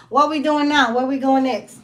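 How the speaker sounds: loud, high, carefree mock family; breezy family moving on. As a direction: toss it off like they forgot you. Family voiced moving on without you.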